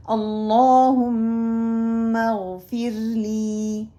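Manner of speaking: slow, drawn-out melodic dua recitation; slow Quranic recitation. Shown as a demonstration. Teacher reciting a dua for students to repeat. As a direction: recite each word long and measured. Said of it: "اگلی دعا ہے".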